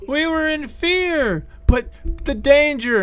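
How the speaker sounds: high, loud frightened townsperson; panicked yell. Acted. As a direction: shout back in fear. Crowd member yelling back.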